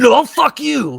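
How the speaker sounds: high-pitched mock indignation; mock angry rebuff. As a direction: act out an indignant, stretched rebuff. Acted rebuff in retold quarrel.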